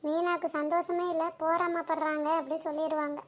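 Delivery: low, secretive reply to the mother-in-law; hushed aside. Acted. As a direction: murmur it so he can't hear.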